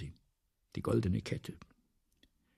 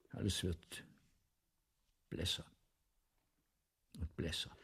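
fading away; 5 semitones lower, 10 dB quieter than usual. Acted. Fading, can't imagine anymore.

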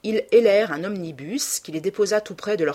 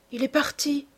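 high, small disappointed voice; wistful, regretful murmur; sad murmur; 8 semitones higher than usual. A narrator's reading. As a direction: let it out sadly, pitched up. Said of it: "murmura tristement Sibyl".

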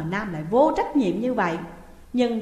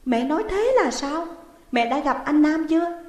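higher, louder anxious demand; alarmed questioning; 6 semitones higher, 4 dB louder than usual. Acted. Daughter demanding answers from her mother, alarmed. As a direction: press mother for the truth in alarm.

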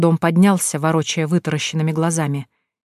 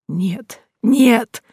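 rising into a high cry; panicked; 6 semitones higher than usual. A narrator's reading. Panicking corpse cries out.

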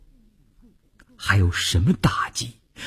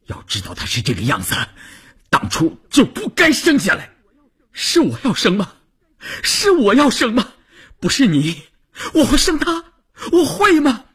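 high, accusing outburst; distraught, angry quarrel. Acted. Wife blamed by husband for the birth, angry and very high. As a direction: climb to an anguished pitch on repeated questions. Quarrel over having the child.

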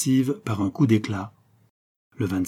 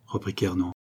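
ordinary delivery

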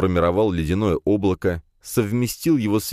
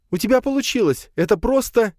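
put-on high, thrilled girl's voice; excited; 3 dB louder than usual. A narrator's reading. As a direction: gush with joy. Nadya's delight continues. (восторженно)

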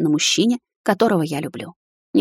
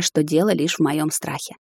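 ordinary delivery, nothing marked

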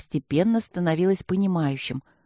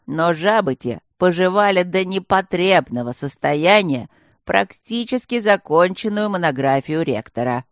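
usual speaking voice

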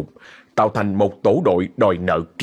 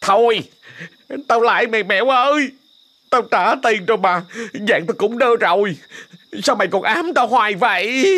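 put-on frightened old man; 12 semitones higher than usual. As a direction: yell back in fear from inside. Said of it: "đáp giọng ra ngoài".

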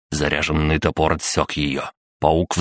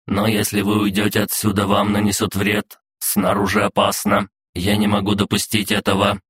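steady, higher monotone robot voice; officious robot guard; 4 semitones higher than usual. Acted. Robot guard reciting its laws to intruders, in an even raised pitch. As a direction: recite it evenly like a machine.

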